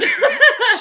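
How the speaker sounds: high, loud burst of laughter. Real, not acted. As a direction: crack up laughing.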